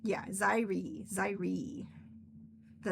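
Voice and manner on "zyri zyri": Zyri said ZY-ree, slowly; demonstrated pronunciation. Shown as a demonstration. Author shows readers how Zyri sounds, demonstrated pronunciation. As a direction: say Zyri with a long "eye" first vowel. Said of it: "how I pronounce it".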